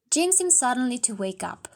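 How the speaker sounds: usual speaking voice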